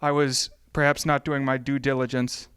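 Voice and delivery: usual speaking voice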